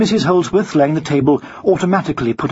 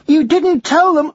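high, slow schoolboy question; alarmed boy; 14 semitones higher, 4 dB louder, slower than usual. Acted. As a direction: check anxiously in a child's voice.

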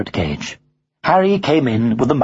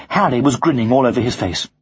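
ordinary delivery

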